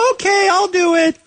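high-pitched mock eager fan; Nick. Host throws the caller's promise back at him, mock eager. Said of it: "And you're like".

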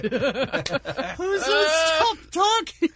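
mock drunk host. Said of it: "echoing the host's line from the tape".

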